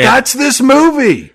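loud, high, drawn-out exclamation; excited shout. Real, not acted. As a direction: burst in loudly with the big point. Excitedly breaks in to agree.

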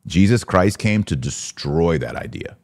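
ordinary talk, nothing marked

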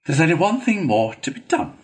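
mildly self-satisfied authority; gratified Badger. A narrator's reading. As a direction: press on, pleased with the result. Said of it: "continued the gratified badger".

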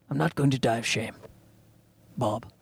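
soft, low half-Hopkins vow to Bob; quiet Hopkins callback. Retold or imitated. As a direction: mutter it like Sir Anthony Hopkins.

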